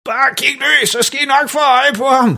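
meget høj, ivrig stemme; falsk begejstret. A narrator's reading. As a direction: hold folks blik oppe. Far råber mens han hiver i træet. (overspillet jubel)

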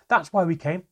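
loud, high-pitched angry yell; child's enraged outburst at a parent; furious shouting. Acted. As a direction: shout it like a blow landing. Child attacking father, shouting.

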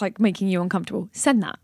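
ordinary delivery

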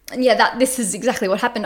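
usual speaking voice

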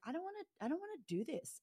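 put-on high, fast childish whine; whiny kid voice. Recalling her twelve-year-old self wanting out, in a whiny high voice.

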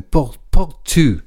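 brief Spanish "Por, por tu", slow and hesitant. Real, not acted. DJ starts a dedication to Jocelyn in faltering Spanish. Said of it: "I was gonna speak in Spanish then".

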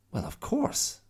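high exclaiming bear voice. Acted. Bear exclaiming to Mia, matter-of-fact. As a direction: say it as if obvious. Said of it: "he exclaimed".

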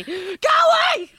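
loud comic yell; action hero shout. Acted. Would-be action star scaring off pigeons, shouting. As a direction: shout it to drive them off. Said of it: "that's what I would be shouting constantly".

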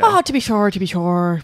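lightly put-on stage Irish accent; leprechaun. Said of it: "insulting impression of a leprechaun".

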